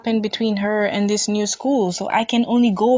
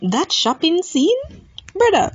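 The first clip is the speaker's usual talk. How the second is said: ordinary delivery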